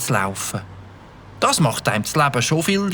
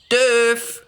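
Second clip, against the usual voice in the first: calling out; 6 semitones higher than usual. Acted. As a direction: call his name so he hears you. Mike calls louder for the motorbike man.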